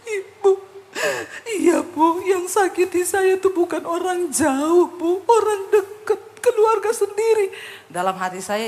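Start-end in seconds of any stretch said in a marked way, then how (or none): 0.1-7.5 high, hammed-up tearful wail; imitated crying. Hurt woman confiding about her own family, mock weeping. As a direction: mimic sobbing out a complaint, drawing words out.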